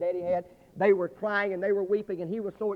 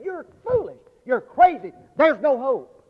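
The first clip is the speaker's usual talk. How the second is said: loud, higher scoffing policeman; mocking officer; 7 semitones higher, 7 dB louder, slower than usual. Policeman mocks the digging father, loud scoffing voice.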